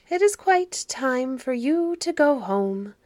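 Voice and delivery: lightly voiced elderly woman; old woman's voice. A narrator's reading. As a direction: send the children home like an old caretaker. Old woman ends the children's visit.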